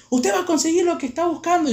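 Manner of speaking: high-pitched teacher's warning; threatening teacher. Retold or imitated. Teacher warns student after long silence, high threat.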